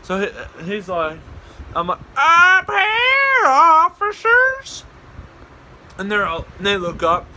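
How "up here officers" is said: very high, loud, drawn-out holler; shrieking call. Retold or imitated. Prankster up a tree to searching officers, high shouted call. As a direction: belt it down from the treetop.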